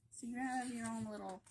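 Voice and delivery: usual speaking voice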